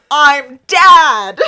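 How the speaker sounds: mock-dramatic scream; screamed reveal. A narrator's reading. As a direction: blast it out melodramatically. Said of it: "And he screams".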